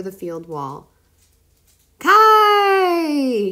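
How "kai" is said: long loud high howl of the name; howling fox. A narrator's reading. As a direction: howl it after him as you run. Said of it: "he howled".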